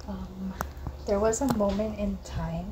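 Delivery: ordinary delivery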